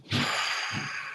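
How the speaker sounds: long, soft breathy sigh out; long exhale. Shown as a demonstration. Guide shows the listener how to let a deep breath go, long exhale. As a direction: let the whole breath go audibly. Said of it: "and let it go".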